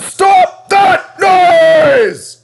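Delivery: high, loud, drawn-out furious yell; angry shout. Acted. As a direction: bellow it at the noisy engine. Angry order to whistling engine.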